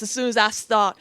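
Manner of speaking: ordinary delivery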